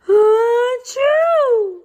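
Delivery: big loud achoo; loud sneeze. Acted. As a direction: blast out the sneeze.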